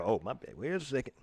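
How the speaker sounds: ordinary talk, nothing marked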